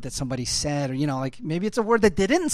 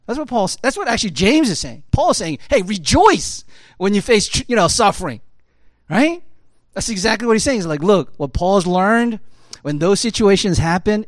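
raised, pressing preacher voice; louder preaching; 4 semitones higher, 8 dB louder than usual. Acted. Preacher urging church to rejoice in suffering, raised voice. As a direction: push the scripture point to the crowd. Preacher quotes James and Paul.

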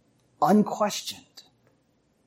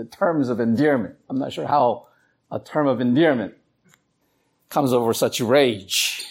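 normal talking voice

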